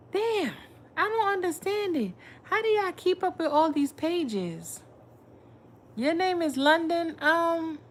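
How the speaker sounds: high excited astonishment; surprised disbelief. Real, not acted. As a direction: exclaim in real surprise. Surprised viewer changed her name.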